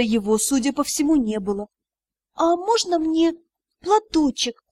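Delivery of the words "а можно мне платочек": ordinary talk, nothing marked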